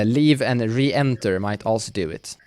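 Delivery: ordinary delivery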